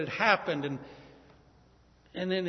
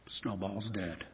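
lower, softer, grieving; sad news; 9 semitones lower, 8 dB quieter, slower than usual. Acted. Father telling the children the lamb is dead, soft and sad. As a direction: break it gently.

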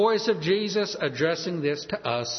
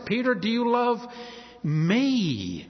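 high question, then "me" set apart and drawn out; pause, then land firmly on "me"; stress on ME; 6 semitones higher, slower than usual. Shown as a demonstration. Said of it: "emphasize one part".

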